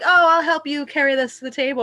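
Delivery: high, loud fake offer to help. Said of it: "they would come in and be like".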